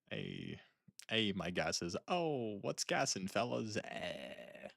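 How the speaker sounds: soft mock Jersey Italian, pitch jumping up midway; hammed-up Italian slang, sliding down to a quiet eh; Italian-American accent. Streamer quoting Italian words to fellas, mock Italian accent. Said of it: "some of the Italian words are".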